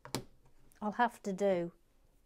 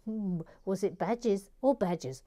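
ordinary talk

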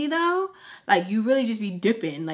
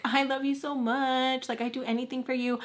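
higher, softened mock-lovey voice; mock-sappy, higher-pitched devotion; lovesick gushing; 4 semitones higher, 4 dB quieter than usual. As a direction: imitate your own gushy love texts. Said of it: "reading my messages".

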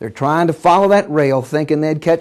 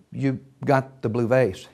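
low, soft delivery; understated, letting it sink in; quiet statement; 5 semitones lower, 8 dB quieter than usual. Acted. Boss to employee who brought the vase, quietly. Ricks quietly confronts Peck.

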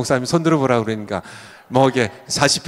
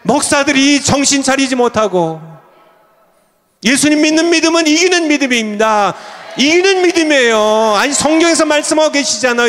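high, loud pulpit exhortation; impassioned high-pitched sermon delivery; full-force preaching; 8 semitones higher, 3 dB louder than usual. Real, not acted. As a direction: drive it home to the congregation in a raised voice.

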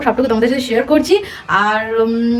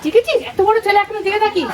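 put-on baby talk; 9 semitones higher than usual. Cooing at baby.

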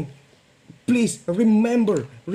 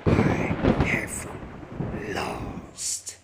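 villain voice; 12 semitones lower, 7 dB quieter than usual. Pretend. Defeated villain vows revenge.

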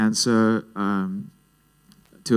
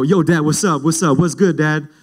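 higher, lightly swaggering gangster greeting; mock gangster; 5 semitones higher, 3 dB louder than usual. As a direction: try to sound like a gangster to your dad. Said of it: "try to be all, like, like, gangster".

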